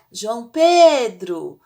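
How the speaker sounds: loud, slow name call; drawn-out, loud summons; calling someone. Shown as a demonstration. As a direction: call out to someone, stretching the stressed vowel. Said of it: "como se você estivesse chamando alguém".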